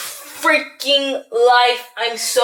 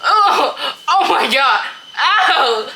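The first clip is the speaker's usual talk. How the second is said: laughing; 3 semitones higher, much slower than usual. Real, not acted. Laughing after getting stuck.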